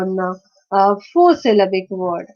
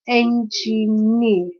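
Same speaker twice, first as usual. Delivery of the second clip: "engineer" slowly as en-gi-neer; syllable by syllable; much slower than usual. Shown as a demonstration. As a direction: break "engineer" into three parts. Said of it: "this is a three-syllabic word".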